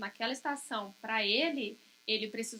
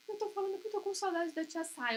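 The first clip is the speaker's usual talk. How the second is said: put-on whiny child insisting; 7 semitones higher than usual.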